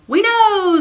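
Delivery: high, loud eager reply; eager child. Acted. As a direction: answer like a thrilled little girl. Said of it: "said Sophie".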